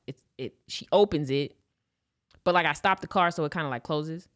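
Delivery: ordinary delivery, nothing marked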